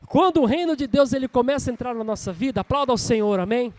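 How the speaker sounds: loud, driving call to the congregation; full-force preaching. Real, not acted. Preacher asks the church to applaud the Lord, rousing.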